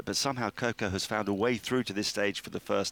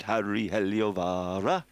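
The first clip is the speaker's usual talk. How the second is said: slow, drawn-out mock Finnish accent; slower than usual. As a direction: say the name in an exaggerated Finnish accent. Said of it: "Is that your Finnish impression".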